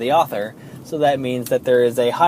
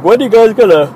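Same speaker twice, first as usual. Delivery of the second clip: quoted fan; 9 semitones higher, 9 dB louder, faster than usual. Retold or imitated. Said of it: "he was like".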